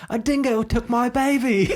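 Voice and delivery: high, hammed-up Australian accent, slow and laughing; bad Australian accent. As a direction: do a horrible Australian accent, like Meryl Streep in the outback. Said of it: "That was a horrible Australian accent".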